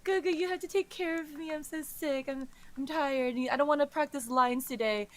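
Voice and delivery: high-pitched bratty whine; whining. Acted. Sick man milking it with his carer, whining. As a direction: whine like a brat playing up being sick. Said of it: "he's, like, whining and saying".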